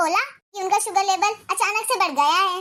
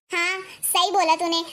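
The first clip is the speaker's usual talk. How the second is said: ordinary talk, nothing marked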